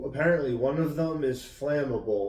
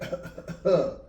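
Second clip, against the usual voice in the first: throat clearing; 6 semitones higher than usual. Retold or imitated. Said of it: "constantly clearing his throat".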